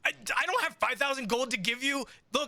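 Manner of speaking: high, fast, pleading voice of a frightened showman; accused showman begging for help, high and frantic; panicked Chaz. Acted.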